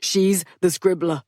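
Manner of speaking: firm insisting voice; insistent. Acted. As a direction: press the point. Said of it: "Theodore insisted".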